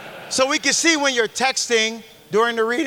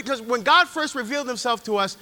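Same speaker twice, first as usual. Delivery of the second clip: normal talking voice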